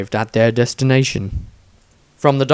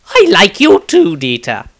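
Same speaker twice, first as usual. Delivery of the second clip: higher, louder sly teasing; wicked grin; 7 semitones higher, 6 dB louder than usual. Acted. Wizard needles Dieter with a wicked, high teasing jab. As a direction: tease him with a wicked smile. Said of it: "Maestro smiled wickedly at this".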